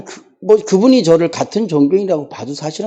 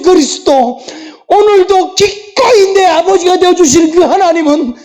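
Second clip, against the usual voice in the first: rising preaching climax; 7 semitones higher than usual. Real, not acted. Pastor leading the church's confession of faith, building to a fervent higher climax. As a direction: build the confession to a ringing peak.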